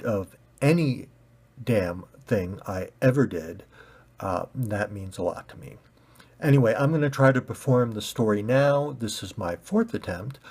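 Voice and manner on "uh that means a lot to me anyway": plain reading voice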